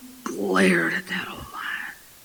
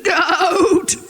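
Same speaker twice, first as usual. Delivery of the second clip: higher, wavering goat bleat on the word; bleating cub; 5 semitones higher, 4 dB louder than usual. Acted. Cub refuses the lion's argument, bleating. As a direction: stretch it into a stubborn baa. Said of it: "shook his head and said".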